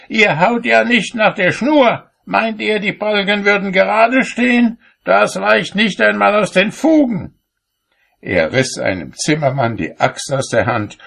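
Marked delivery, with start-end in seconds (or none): 0.1-7.3 high, loud fault-finding; indignant call. A narrator's reading. Cobbler berates the carpenters, high-pitched indignant shouting. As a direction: challenge them at a very high pitch. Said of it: "rief er".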